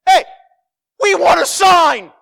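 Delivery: high, loud demanding shout; mock shout. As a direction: yell it like a pushy crowd demanding proof. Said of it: "they're like".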